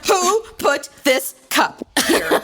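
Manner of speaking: slow, high, hammed-up outrage; Joan Crawford. Mocking a jealous wife over the cupboards, melodramatic outrage. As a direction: demand it like an imperious movie tyrant. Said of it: "it's like Joan Crawford".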